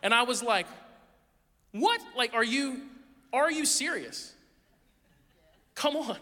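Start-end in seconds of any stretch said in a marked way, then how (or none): 1.7-6.2 higher, slow what-are-you-serious protest; indignant disbelief. Retold or imitated. Dad protesting to the ref after his ejection, higher indignant disbelief. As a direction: act stunned at being thrown out.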